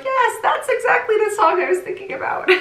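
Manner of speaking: high, laughing exclamation; giddy, high-pitched eureka with laughter; mock elated recognition. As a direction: imitate yourself delightedly recognizing it, laughing through it. Acts out delighted reaction to commenters, laughing.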